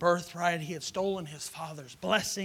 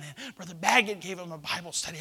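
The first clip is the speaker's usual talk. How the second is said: normal talking voice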